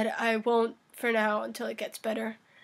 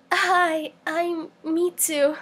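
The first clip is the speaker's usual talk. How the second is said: high, slow yandere greeting; giggle, then a drawn-out high introduction; sweetly unhinged anime yandere; 5 semitones higher, much slower than usual. Acted. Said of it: "what you think is a suitable yandere voice".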